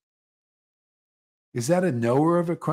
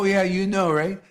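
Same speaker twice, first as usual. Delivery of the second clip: ordinary talk, nothing marked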